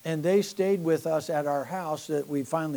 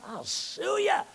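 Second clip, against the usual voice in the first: a squeaky, much higher teasing "I'll sue ya"; playful threat; slower than usual. Pretend. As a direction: toss it off as a cheeky joke. Joking threat to critics.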